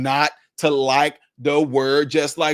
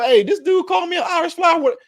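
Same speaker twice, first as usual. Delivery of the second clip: puzzled asking around; 7 semitones higher than usual. Retold or imitated. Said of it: "you're gonna start asking people".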